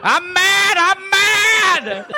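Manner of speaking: high, drawn-out imitation yell; high-pitched mock cry; mock madman's yell. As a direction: echo the fleeing madman, hammed up. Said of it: "out of the house yelling".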